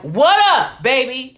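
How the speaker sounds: hammed-up slang hello; imitated slangy greeting. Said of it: "That's how she'd talk to me".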